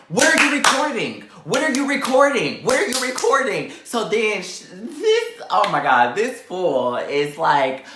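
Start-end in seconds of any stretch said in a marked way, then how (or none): 0.1-3.7 high, loud repeated challenge; retold confrontation. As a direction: call it out to announce yourself. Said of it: "I let it be known that I was there".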